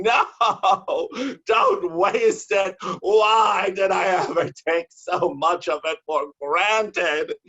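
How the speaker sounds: high, loud, slow anguished yell; mock screaming. Poet acting himself yelling at porn, mock anguished screaming. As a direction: wail like losing something precious. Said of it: "I start screaming".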